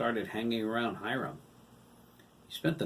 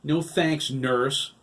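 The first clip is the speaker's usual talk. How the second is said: grumbling; 5 semitones higher, 9 dB louder, much slower than usual. A narrator's reading. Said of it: "Todd grumbled".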